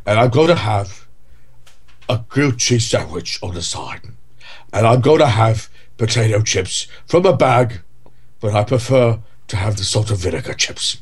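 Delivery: idle rambling in a mild Michael Caine voice; Michael Caine impression. Podcast host orders his last meal in a mild Michael Caine voice. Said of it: "I just have to talk like him".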